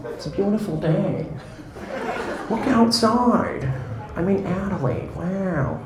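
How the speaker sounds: high, soft, slow and wondering, full of wow; breathy surfer amazement, stretch it out slowly; Owen Wilson impression. Owen Wilson as pilot greeting passengers, soft and awed. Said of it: "imagine you had Owen Wilson as your pilot".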